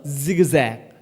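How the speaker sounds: 지그재그 said with buzzing z sounds; zigzag with English Z. Shown as a demonstration. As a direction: voice each ㅈ in 지그재그 as z. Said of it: "이렇게 얘기하는 거야".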